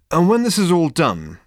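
loud, high furious outburst; savage. A narrator's reading. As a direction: hurl it out savagely. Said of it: "said Zaphod savagely".

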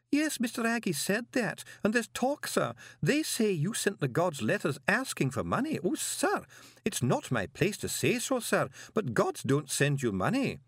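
high-pitched, fretful woman's voice; distressed clerk. A narrator's reading. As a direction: protest anxiously in a high, plaintive voice. Voices the upset Miss McAlariat.

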